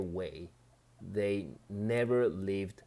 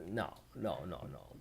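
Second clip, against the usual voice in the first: ordinary talk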